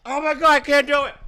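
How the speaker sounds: high, on the verge of gagging. Real, not acted. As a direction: fight back the urge to gag. About to gag on bean.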